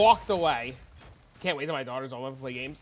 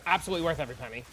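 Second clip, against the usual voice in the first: fast; 2 semitones lower, much faster than usual. Real, not acted. Rapid first take.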